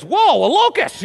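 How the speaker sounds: high, delighted gasp at a locust; mock surprise. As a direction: play John thrilled by his snack. John the Baptist voiced mockingly.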